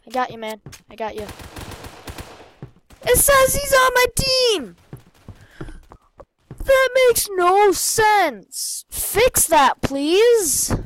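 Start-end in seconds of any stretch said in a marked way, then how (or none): 3.0-10.8 high, loud, exasperated outcry; frustrated yell. Real, not acted. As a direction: yell it high at the broken game. Frustrated at glitchy teammate.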